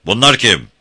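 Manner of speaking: scornful question, loud and high; Beşvayo. A narrator's reading. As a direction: demand it with contempt. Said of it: "sorusu aşağılama doluydu". (aşağılayarak, yüksek sesle)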